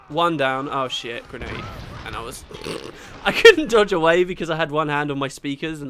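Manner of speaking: ordinary talk, nothing marked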